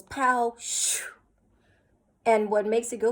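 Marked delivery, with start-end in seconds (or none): 0.0-1.0 punchy "pow", then airy whoosh; imitated firing sound. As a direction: act out a shot zooming off. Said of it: "once the axon fires, it goes".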